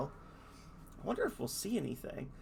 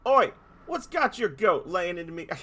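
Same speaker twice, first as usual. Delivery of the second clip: loud, hammed-up Australian tough guy; Australian accent; 5 semitones higher, 8 dB louder than usual. Dolphin voicing an Australian accent at the heroes. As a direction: bark it like an Aussie bloke picking a fight. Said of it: "I cannot do this voice justice".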